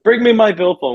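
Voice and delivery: a light mock of a stubborn call from bed; insistent old man. Repeats the dying man's call.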